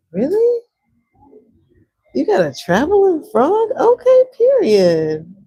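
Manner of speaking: high, loud, delighted disbelief; gasp, then hype it up loudly; excited amazement. Real, not acted. Streamer hears viewer has a traveling frog, amazed and excited.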